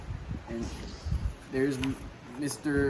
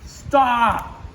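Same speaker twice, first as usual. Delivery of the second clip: high, loud call; shout stop; 9 semitones higher, 7 dB louder than usual. A narrator's reading. Donald calling out to stop for a drink, loud. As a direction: call out to halt.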